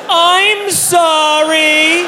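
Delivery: high, drawn-out wailed apology; mock yell. Patient apologizing to the doctor mid-exam, long mock yell. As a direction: wail it slowly, holding each word high. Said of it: "So I yelled".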